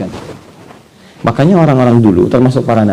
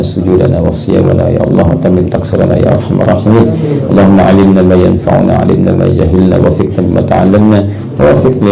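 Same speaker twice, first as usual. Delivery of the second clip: low, rapid monotone supplication; fast formulaic Arabic du'a; closing Arabic prayer; 4 semitones lower, much faster than usual. Real, not acted. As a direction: recite the prayer quickly on one low, even pitch.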